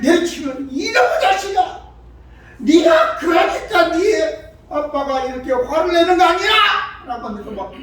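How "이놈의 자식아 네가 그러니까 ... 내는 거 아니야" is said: high, loud, hammed-up telling-off; acted shrieking reproach that climbs at the end; scolding mother. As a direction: rail at the child in mock fury. Acted mother scolding and hitting child.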